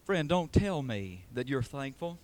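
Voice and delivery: ordinary delivery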